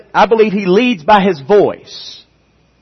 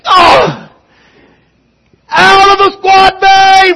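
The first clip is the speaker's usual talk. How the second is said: high, drawn-out loud bellow; shouted military order; 14 semitones higher, 7 dB louder, slower than usual. Acted. Instructor clearing recruits from squad bay, bellowed command. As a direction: order the whole squad out at full voice. Acts out order after botched count.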